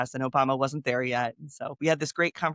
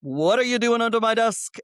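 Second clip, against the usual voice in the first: higher, louder presidential impression; playful Obama impression, pitched up; 7 semitones higher, 4 dB louder than usual. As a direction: do your best Obama, friendly and surprised. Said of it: "in this Obama voice".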